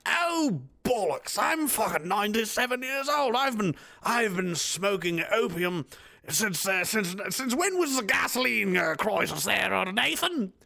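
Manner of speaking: put-on high, slow old English lord voice; stoned old British lord complaining. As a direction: drawl like a stoned ancient aristocrat. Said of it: "And he'll be like".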